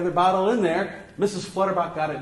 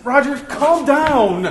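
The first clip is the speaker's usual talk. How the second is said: loud, high and drawn-out "calm down"; firm calming command; 7 semitones higher, 7 dB louder, much slower than usual. Acted. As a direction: cut through his panic forcefully. Cuts off the panicking actor.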